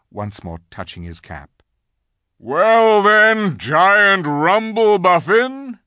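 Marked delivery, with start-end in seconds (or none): 2.4-5.8 high, loud, stretched-out call; commanding lion. A narrator's reading. Aslan addresses the giant up above, loud and commanding. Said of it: "said Aslan".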